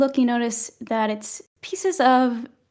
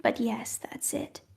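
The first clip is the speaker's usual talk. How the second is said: soft hushed whisper; whispered aside; 10 dB quieter than usual. Acted. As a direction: drop to a secret whisper.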